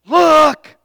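sudden high, loud screech of alarm; a loud, high terrified wife's cry; terrifying screech. Retold or imitated. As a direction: shriek it out like she's seen a ghost. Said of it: "in the most terrifying voice I've ever heard, screeched".

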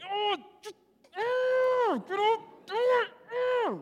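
very high, strangled frustrated groaning; strained exasperation. Retold or imitated. Dad losing patience with daughter on the floor, strangled exasperation. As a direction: squeeze the words out through mounting frustration. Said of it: "I can feel it. I'm like".

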